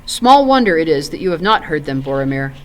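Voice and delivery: usual speaking voice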